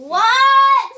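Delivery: high, very loud "What?"; yelling. Real, not acted. Player who can't hear, yelling at sister. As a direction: bellow it, drawn out. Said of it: "stop yelling at me".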